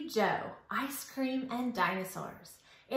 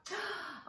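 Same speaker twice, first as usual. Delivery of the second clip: sharp gasp; 9 semitones higher, 9 dB quieter than usual. Acted. Gasp as story turns sad.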